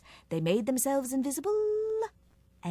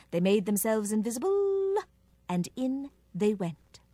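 ordinary delivery, nothing marked

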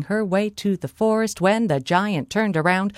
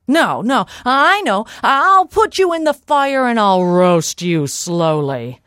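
loud, excited giant's threat; menacing giant; 4 semitones higher, 6 dB louder than usual. Acted. Giant threatens to roast Molly, loud gloating menace. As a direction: burst in loudly with a crueler idea.

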